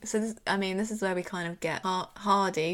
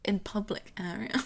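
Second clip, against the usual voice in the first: whispered shock. Retold or imitated.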